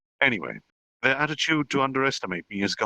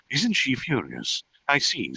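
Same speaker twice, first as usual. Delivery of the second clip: ordinary delivery, nothing marked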